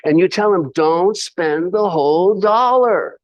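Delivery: lightly imitating Jim Rohn's funny accent, stretching the advice. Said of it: "In his, his funny, uh, accent".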